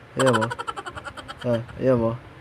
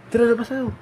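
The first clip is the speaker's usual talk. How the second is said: much higher, louder eager call; excited invite; 10 semitones higher, 8 dB louder, much faster than usual. Acted. Owner calling the dog outside, high excited invitation.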